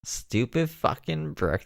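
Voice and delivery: slow, high teasing readout; high mock read. Real, not acted. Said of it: "Stupid F K N Brooke".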